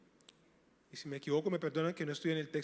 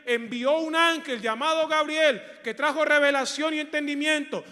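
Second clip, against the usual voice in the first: high, loud sermon push; fiery preaching; 8 semitones higher, 9 dB louder than usual. Acted. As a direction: preach it hard to the church. Preaching builds to full force. (predicación enérgica y fuerte)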